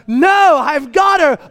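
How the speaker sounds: high, loud re-enacted refusal; angry insistence. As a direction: shout your wife away, insistently. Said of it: "I'm shouting at her".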